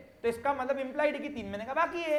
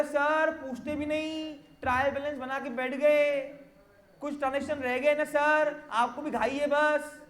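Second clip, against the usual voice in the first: whining client; 3 semitones higher, slower than usual. Acted. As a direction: grumble at the accountant, stretching the phrase endings. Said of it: "अब तू रो रहा, रो रहा काहे को है भाई". (रोते हुए क्लाइंट की नकली शिकायत)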